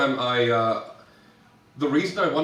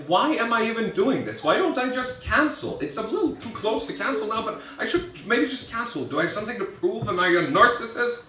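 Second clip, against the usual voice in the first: higher-pitched retold self-questioning; mild anxious spiralling; 8 semitones higher than usual. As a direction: let the doubts tumble out, mock-fretful. Retold spiralling self-doubt after crying.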